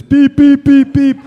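high, loud imitated bleep tones; loud high-pitched monotone beeping; censor beeps. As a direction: mimic a censor bleeping out swear words. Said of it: "four letter expletives".

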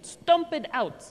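hyped-up. Shown as a demonstration. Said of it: "turn to [name]".

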